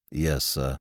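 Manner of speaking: slow, low, quiet manservant; measured, deferential valet; Jeeves, the butler. Acted.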